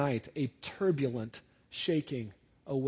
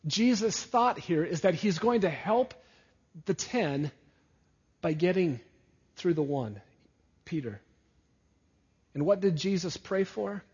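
ordinary delivery

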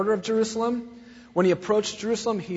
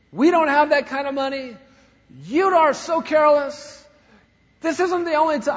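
louder, higher mock scolding; angry father; 9 semitones higher, 7 dB louder than usual. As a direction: scold a careless daughter. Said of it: "he was so mad".

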